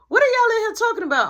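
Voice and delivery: high, loud, fast demand; suspicious mom. Retold or imitated. Mom bursts in, loudly demanding what the boys discuss. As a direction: demand answers like a suspicious mother.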